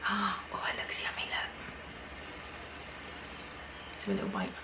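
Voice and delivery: soft unvoiced whisper. Real, not acted. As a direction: whisper as if sharing a secret. Whispering while tasting.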